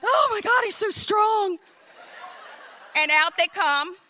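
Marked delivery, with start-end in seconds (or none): 0.0-1.6 high, swooning, with a laugh; giggly smitten gasp; swooning admiration. Retold or imitated. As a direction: gush over his strength. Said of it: "I'm like".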